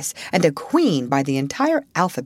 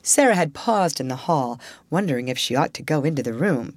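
ordinary delivery

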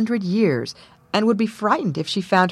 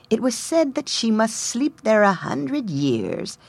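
put-on higher, gentle old peasant; 4 semitones higher than usual. A narrator's reading. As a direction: tell it like a humble old man. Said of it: "an old peasant was found who said".

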